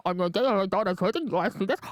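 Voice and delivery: fast, garbled godfather impression; badly faked mafia don, like a cartoon alien; Marlon Brando. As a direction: rattle it off in a mangled don voice. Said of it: "my impersonation of Marlon Brando Zoidberg".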